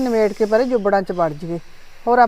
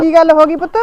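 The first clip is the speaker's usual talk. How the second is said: louder, higher anxious question; frightened mother asking what happened; worried, loud; 6 semitones higher, 9 dB louder than usual. Acted. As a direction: call out to her in alarm. Mother rushes to crying daughter.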